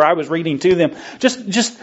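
ordinary talk, nothing marked

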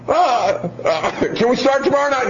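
put-on high, hesitant child voice; put-on squeaky plea; pleading kid. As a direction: bargain with mom to put it off. Child pleads to delay new rule.

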